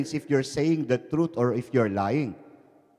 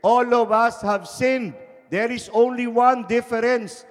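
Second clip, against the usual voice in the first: rising preaching force; 11 semitones higher, 6 dB louder than usual. Acted. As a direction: drive it home to the prisoners. Preacher building to a punchline.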